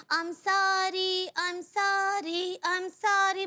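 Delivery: very slow, drawn-out mock apology; mimicking repeated apologies. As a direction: stretch each sorry in teasing imitation. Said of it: "बोल बोल के जो है वो दिमाग खा जाते हैं".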